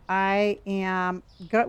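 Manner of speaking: ordinary talk, nothing marked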